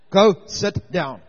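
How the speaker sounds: loud, slow and firm; exasperated order. Retold or imitated. Pastor sending the weeping woman back to her seat, loud and slow. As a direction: spell out the dismissal word by word, fed up. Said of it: "I had enough".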